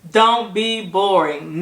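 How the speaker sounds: normal talking voice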